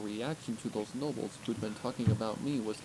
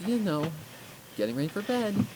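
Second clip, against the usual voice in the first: nervous; 8 semitones higher, 12 dB louder than usual. Acted. Twilight covering up to the princess, nervous and high. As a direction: force a too-bright denial. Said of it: "There's the nervous look".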